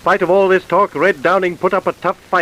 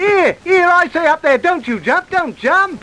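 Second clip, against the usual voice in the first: shouting up; 10 semitones higher than usual. Acted. Shouting up to window.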